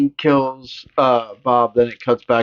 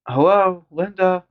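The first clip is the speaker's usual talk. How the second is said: much higher, puzzled; mock Lori; 8 semitones higher, slower than usual. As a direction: ask it like a ditzy girl. Voicing Lori calling.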